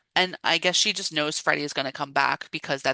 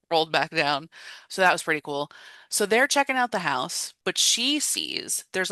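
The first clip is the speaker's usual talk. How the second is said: ordinary delivery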